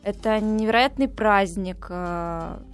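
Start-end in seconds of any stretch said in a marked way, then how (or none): none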